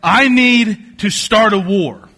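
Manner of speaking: mock angry demand. British man writing Parliament for war, mock angry. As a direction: demand war like a petty hothead. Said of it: "gets so mad that he writes Parliament".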